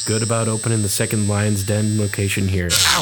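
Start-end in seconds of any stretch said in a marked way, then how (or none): 2.7-3.0 high, loud "ow"; sudden pained yelp. Acted. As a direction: yelp as if zapped by a shock. Razor shocks him mid-sentence.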